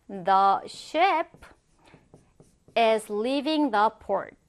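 slow model sentence with a long pause midway; slow dictation. Shown as a demonstration. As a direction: read it out piece by piece for learners. Word-by-word model sentence for learners.

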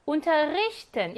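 운터리히튼 slowly, stressed on 리히; slow teaching demo of 운터리히튼, 리히 stressed; marked word stress. Shown as a demonstration. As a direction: lean on 리히 in 운터리히튼. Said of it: "여기에 강세 있어요".

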